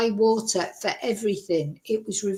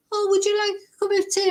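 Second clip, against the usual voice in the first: quick, much higher, mock-sweet hostess voice; sweet lady offering tea; 10 semitones higher, faster than usual. Kind neighbour lady offers thirsty guests tea, mock-sweet and high.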